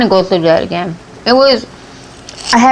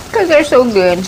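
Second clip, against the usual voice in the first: ordinary talk, nothing marked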